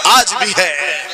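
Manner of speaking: loud, high cry of devotion, drawn out at the close; ringing religious oratory; impassioned preacher. Real, not acted. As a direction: proclaim it to a big crowd.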